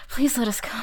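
high, loud desperate begging; loud pleading. Acted. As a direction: beg at full pitch.

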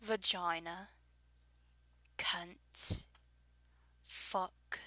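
put-on English accent; fake British accent. Shown as a demonstration. Reading a word list for an accent challenge, fake British accent. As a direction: say it as if faking being British. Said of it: "fake British accent, fake English accent".

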